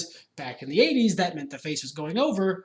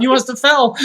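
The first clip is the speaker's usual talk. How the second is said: a louder, hammed-up innocent excuse; innocent denial; 5 semitones higher, 10 dB louder than usual. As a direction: blame the ref lightly.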